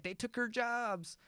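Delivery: high, slow put-on yokel voice; mock bigot voice. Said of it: "'cause they were like".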